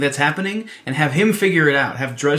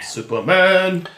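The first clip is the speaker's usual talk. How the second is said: slow, stretched heroic proclamation; heroic announcer call; 6 semitones higher, much slower than usual. Acted. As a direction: proclaim it like a movie trailer. Hails hero after question.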